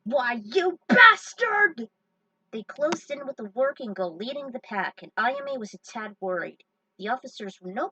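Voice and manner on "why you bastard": hammed-up slow furious shout, much higher and louder; drawn-out outraged yell at the villain; mock outrage. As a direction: bellow it like a cartoon hero insulted.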